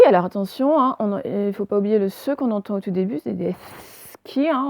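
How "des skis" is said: "skis" with a long hissed sss before ki; "skis" said as sss-ki, the s held; drawn-out S. Shown as a demonstration. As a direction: stretch the s at the start of "skis". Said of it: "on l'entend bien, le S".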